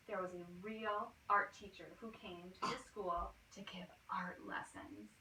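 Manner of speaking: soft unvoiced whisper. A narrator's reading. Whispering the news of the art teacher. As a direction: hush it like a secret.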